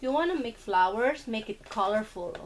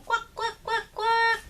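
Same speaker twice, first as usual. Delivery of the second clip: crying duck quacks; 12 semitones higher than usual. Pretend. Mom voices crying toy duck.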